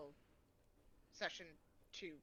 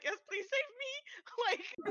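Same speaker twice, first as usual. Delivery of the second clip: slow, high pleading cry; mock desperate plea; 12 semitones higher, much slower than usual. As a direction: beg to be rescued, hammed-up. Begging to be saved.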